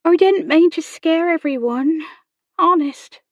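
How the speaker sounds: put-on high, loud pleading girl; upset servant girl insisting she meant well; girl close to tears. A narrator's reading. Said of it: "chin quivering".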